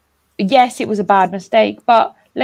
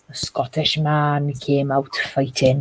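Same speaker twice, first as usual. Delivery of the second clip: lightly put-on Scottish, lower and softer; a weak stab at a Scottish brogue; half-hearted Scottish accent; bad Scottish accent; 5 semitones lower, 5 dB quieter, slower than usual. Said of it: "That was a shit Scottish accent".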